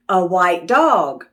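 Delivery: usual speaking voice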